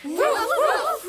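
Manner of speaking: high yappy woof woof; dog barking. Acted. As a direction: bark like a small dog. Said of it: "the dogs went".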